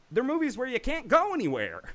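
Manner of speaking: high, drawn-up mimicking voice; Matt Cragg impression. Host quoting a show regular on isolation movies, high-pitched impression. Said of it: "that was a good impression of him".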